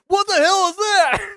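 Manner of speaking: very high, drawn-out scared cry; terrified shriek. Retold or imitated. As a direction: scream the question in horror. First sight of the girl.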